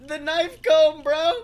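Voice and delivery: loud, high, gleeful brag; excited boasting. Real, not acted. Bragging to his cohost about the knife comb, loud and gleeful.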